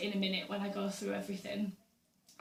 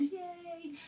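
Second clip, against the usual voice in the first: mock cheer; 9 semitones higher, 5 dB quieter than usual. Real, not acted. Celebrating her birthday weekend.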